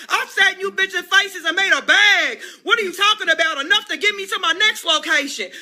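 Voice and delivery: usual speaking voice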